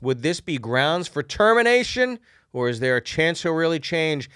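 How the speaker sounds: lively letter read; reading a letter aloud. Acted. Reads listener letter aloud.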